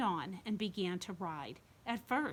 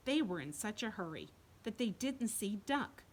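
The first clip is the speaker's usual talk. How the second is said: plain reading voice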